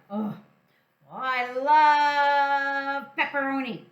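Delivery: loud, slow, drawn-out gush; delighted. Acted. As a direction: swoon over the pepperoni. Hen delighted about pepperoni.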